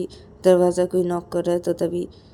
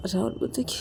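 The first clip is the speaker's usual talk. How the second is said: ordinary delivery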